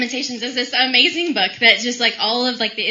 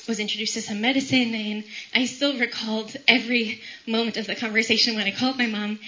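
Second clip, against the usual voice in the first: normal talking voice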